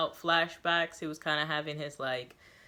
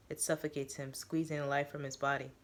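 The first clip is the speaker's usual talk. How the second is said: ordinary delivery, nothing marked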